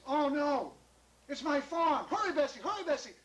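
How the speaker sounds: high, loud, urgent calling; alarmed cry. Acted. John sees his farm smoking, alarmed shouting. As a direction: cry out in panic to hurry.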